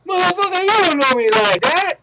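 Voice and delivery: high, very loud mock fury; hammed-up outraged shout; imitated angry yelling. Young guy after being mushed in the face, mock furious. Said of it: "And then he go".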